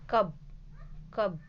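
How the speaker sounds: कब said with a short 'uh' vowel; short U sound. Shown as a demonstration. Teacher showing pupils the short sound of U, कब with a short vowel. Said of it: "इसका उच्चारण short होता है".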